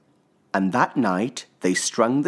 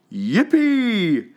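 excited; 7 dB louder than usual. A narrator's reading. Joyful exclamation in narration.

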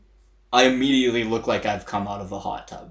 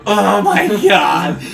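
loud, high, drawn-out cry of dismay; panicked outburst; 8 semitones higher, 9 dB louder, much slower than usual. Retold or imitated. Suspect reacting to detectives' car question, retold loud panic. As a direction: blurt it out in slow, loud horror.

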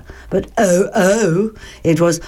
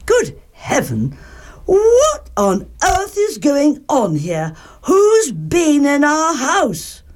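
high, indignant storybook mother; exclaim, rising high in dismay; stretched-out outraged questioning; shocked Mother Bear; 7 semitones higher than usual. A narrator's reading.

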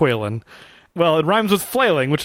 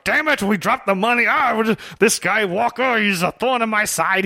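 grumpy villain grumbling; 5 semitones higher than usual. Retold or imitated. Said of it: "He's so angry about everything".

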